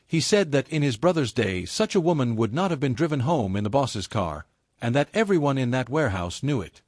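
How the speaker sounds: ordinary delivery, nothing marked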